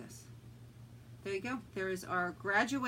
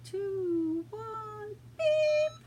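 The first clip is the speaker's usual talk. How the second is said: imitating a timer; 11 semitones higher, much slower than usual. Mimics the machine's countdown timer.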